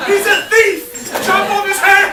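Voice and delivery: high, loud, slow furious yells; shouting death. Retold or imitated. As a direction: scream for blood at the screen. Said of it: "shouting death to this fucking man".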